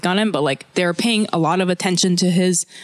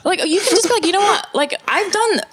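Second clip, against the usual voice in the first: incredulous laughing rant; 11 semitones higher than usual. Retold or imitated. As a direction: laugh while scolding someone for crying over nothing. Exasperated at the crying teacher.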